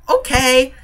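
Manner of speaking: high, sing-song fake-agreeable reply; smiling compliance. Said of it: "I just smile".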